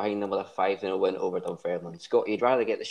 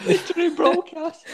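mocking echo, high and loud through laughter; laughing imitation; 7 dB louder than usual. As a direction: parrot the blunder while cracking up. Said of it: "that was about four accidents in one".

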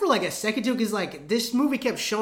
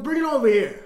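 higher, loud mobster calling for food; mock mobster demand; 4 semitones higher, 5 dB louder than usual. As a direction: bark a hammed-up order across the table. Acted mobster demanding gabagool.

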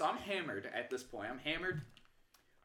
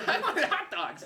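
high, loud comic outburst; mock protest; 13 semitones higher, 8 dB louder than usual. Drunk fan stuck in the nacho line, high loud protest. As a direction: cry out in exaggerated dismay.

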